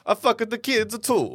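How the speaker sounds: hammed-up Italian afterthought; mock Italian accent. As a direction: draw it out in a fake Italian accent. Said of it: "is he Italian?".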